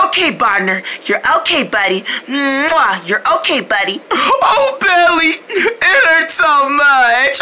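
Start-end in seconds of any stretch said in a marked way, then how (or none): 2.3-3.1 kiss sound. Acted. Kisses crying friend. 4.1-7.4 hammed-up crying. Puppet still crying.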